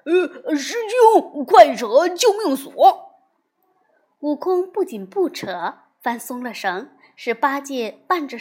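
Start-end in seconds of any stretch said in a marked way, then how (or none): 0.1-2.7 panicked shout. A narrator's reading. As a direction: yell while fleeing, much louder and higher. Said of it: "边跑边叫道".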